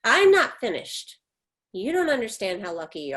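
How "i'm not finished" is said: firm cutoff. Acted. Mother cuts off apology.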